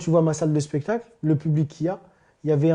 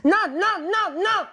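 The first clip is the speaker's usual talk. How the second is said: put-on high, frightened cry of an old lady; panicked old lady; much slower than usual. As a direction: squeal no like a scared old woman at the cash machine. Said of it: "elle me fait". (vieille dame paniquée, voix très aiguë)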